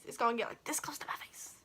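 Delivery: breathy hushed whisper, as if the needle is inches away; retold panicked whisper. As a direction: whisper it in nervous dread. Retelling her panic at the needle.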